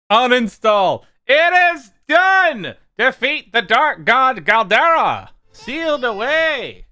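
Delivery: loud, high hammed-up gamer roar; raging gamer. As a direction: bellow it like a crazed winner. Game finished, shouts triumphantly.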